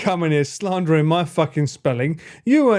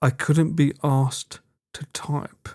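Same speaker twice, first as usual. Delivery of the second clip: slow mock read; 3 semitones lower, slower than usual. Shown as a demonstration. Rereading chat reply slowly.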